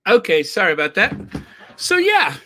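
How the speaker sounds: loud, high, laughing apology; amused, voice lifted and loud. Real, not acted. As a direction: laugh through a quick apology. Laughing after stopping the clip.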